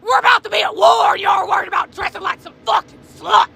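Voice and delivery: furious, loud, high rant; angry shouting. Real, not acted. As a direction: yell it in outrage. Ranting at Halloween costumes.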